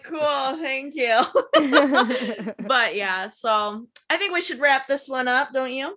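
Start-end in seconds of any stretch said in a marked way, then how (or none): 0.0-2.5 laughing thanks. Real, not acted. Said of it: "mock gratitude, laughing".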